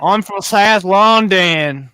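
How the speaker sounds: loud, drawn-out South London Cockney; hammed-up London accent, played for laughs. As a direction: put on a high, slow, exaggerated Cockney accent. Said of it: "give us your best Cockney accent".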